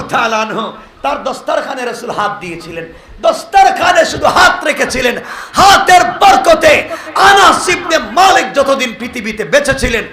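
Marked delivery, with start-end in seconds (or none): 3.2-10.0 full-force preaching. Acted. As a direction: stretch each word out in a fervent cry. Sermon on the blessing of the hand.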